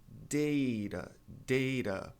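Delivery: "Data" as DAY-ra, with a tapped T; long A, flapped T. Shown as a demonstration. Teacher giving an example word, DAY-ra with flap. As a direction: say "Data" with an "ei" vowel and tap. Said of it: "pode ser data também".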